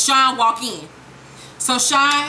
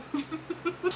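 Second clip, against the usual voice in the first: soft laugh; 5 semitones higher, 13 dB quieter than usual. Real, not acted. Laughs at the scene.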